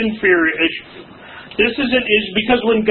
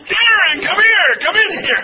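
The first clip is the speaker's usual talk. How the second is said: alarmed parent shout; 12 semitones higher than usual. Retold or imitated. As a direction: call the boy in fast before the neighbors hear. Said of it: "It's like".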